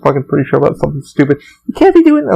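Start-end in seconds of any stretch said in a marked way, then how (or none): 1.7-2.3 high, sharp mock scolding of the lead; irritated retort. To the lead who annoyed him, a high mock scold. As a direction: snap it at her, half-joking. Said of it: "what I wanted to fucking say to her".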